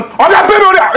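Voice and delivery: pleading up high for his money; squeaky begging whine; whiny high plea. Acted. Waiter begging the customer to pay, high whine.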